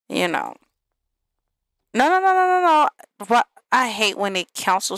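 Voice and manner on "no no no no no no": high, loud run of no's; real protest. Real, not acted. Player yelling at her Sims in protest, high and loud. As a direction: cry out no to stop it happening. Reacting to the Sims' unwanted action.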